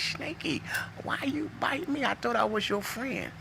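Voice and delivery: high, half-whispered "why you bite me"; hurt and betrayed. Retold or imitated. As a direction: plead with the snake, wounded and high. Bitten man asks the snake.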